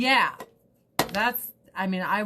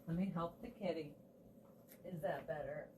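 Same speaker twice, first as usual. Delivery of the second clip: ordinary talk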